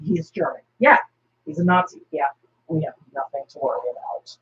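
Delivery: low, dry deadpan; Batman voice. Retold or imitated. Batman reassuring Steve, low and deadpan. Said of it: "And Batman goes".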